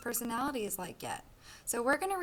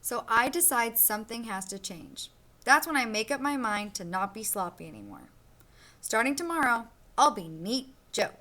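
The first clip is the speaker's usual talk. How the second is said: lively storybook read-aloud for a class; reading to children; 3 dB louder than usual. Acted. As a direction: read it like a picture book to kids. Teacher reads picture book aloud.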